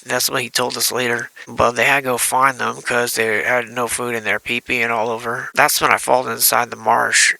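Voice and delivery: ordinary delivery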